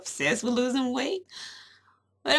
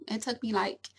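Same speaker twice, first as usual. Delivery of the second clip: ordinary talk, nothing marked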